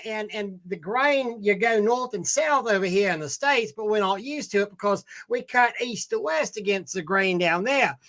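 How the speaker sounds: put-on Australian accent. Diner explaining beef to his waiter, Australian accent. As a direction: tell it like an Aussie to his waiter. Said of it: "He was doing an Australian skit".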